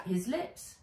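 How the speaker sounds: ordinary delivery, nothing marked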